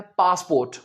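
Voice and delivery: PASSPORT as "paas-port", long aa; British-Indian pronunciation. Shown as a demonstration. Teacher showing how we say it, "paasport" पासपोर्ट. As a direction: say पासपोर्ट with open aa and full "port". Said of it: "जैसे हम बोलते हैं".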